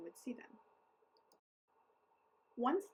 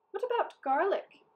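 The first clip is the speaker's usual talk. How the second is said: eager offer; 10 semitones higher, 8 dB louder than usual. A narrator's reading.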